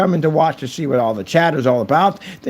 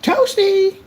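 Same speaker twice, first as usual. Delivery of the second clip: very high squeaky game announcer cry; Mortal Kombat toasty call; 3 dB louder than usual. Retold or imitated. Quoting the fighting game, a squeaky high cry. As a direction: squeal it like the arcade voice.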